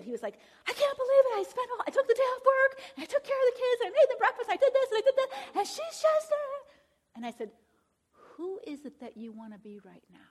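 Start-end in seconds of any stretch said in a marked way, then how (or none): 0.7-6.7 high, loud exasperated rant; imitating a frantic husband. Husband venting to the counselor in a high, loud rant. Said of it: "he was, like, losing it". 8.1-10.2 whisper. Retold or imitated. Counselor calmly redirecting husband.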